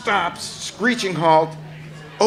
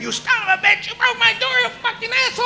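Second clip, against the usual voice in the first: high shrieking rant at the cowboy; furious screaming woman; 3 dB louder, faster than usual. Retold or imitated. Station woman screaming at the cowboy who broke her door, furious high shriek.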